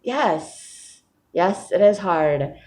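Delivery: high yes, then a slow, dragged it is hard; mock exhausted yes, drawn out; weary agreement. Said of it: "And I was like".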